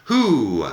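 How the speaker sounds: "Who." said as a plain H sound plus a long U vowel, with no W; who pronounced slowly. Shown as a demonstration. Spanish teacher modelling "Who." for students, clear and deliberate. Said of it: "Es como si fuera H y una U larga".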